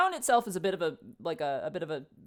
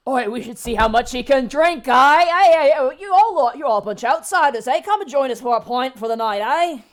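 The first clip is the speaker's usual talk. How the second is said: put-on higher, much louder drunken invitation; rowdy dwarf; 7 semitones higher, 13 dB louder than usual. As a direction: bellow a pint invite at outsiders. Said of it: "He goes".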